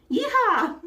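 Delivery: high, loud cowboy whoop; excited yeehaw. Acted. Streamer cheering along with chat, loud high whoop.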